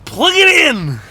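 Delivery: loud, high, slowed mock scolding; exasperated outburst. Rancher mocking cold start videos, loud exasperated scolding. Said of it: "I'm like".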